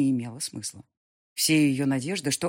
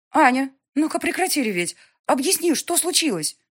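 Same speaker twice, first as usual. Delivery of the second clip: higher, louder firm order; stern mother; 10 semitones higher, 8 dB louder than usual. Acted. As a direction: snap her out of hysterics. Mother orders her to stop crying.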